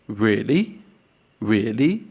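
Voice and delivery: "really" dipping, then rising in doubt; questioning tone. Shown as a demonstration. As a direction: ask "really" with a questioning lift at the end. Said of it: "questioning tone".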